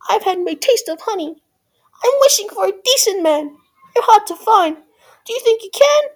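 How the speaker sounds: put-on high-pitched, girlish princess voice; pleading Cindy. A narrator's reading. Cindy asking the fairy for a decent man, high-voiced.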